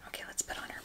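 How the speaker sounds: soft unvoiced whisper. Real, not acted. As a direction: whisper it to yourself. Dolls vlog, hushed aside.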